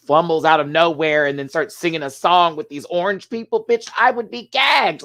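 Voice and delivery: loud, rising to a high exclamation; excited disbelief. Real, not acted. Reviewer picturing themself as Charlie in the factory, excited disbelief. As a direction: build to an astonished outburst. Imagines astonishment at the film scene.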